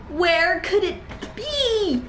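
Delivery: high and slow, puzzled searching; high questioning. Acted. Searching in horror game.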